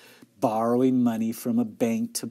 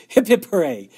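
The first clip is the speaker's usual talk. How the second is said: raised, loud retort; yelling back; 6 semitones higher, 8 dB louder than usual. A narrator's reading. Older sister in a squabble, yelling back. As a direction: keep yelling the chant back at her. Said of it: "Beezus yelled back".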